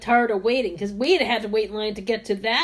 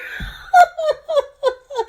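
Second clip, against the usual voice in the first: high squealing laugh; 5 dB louder than usual. Real, not acted. Teller bursts out laughing.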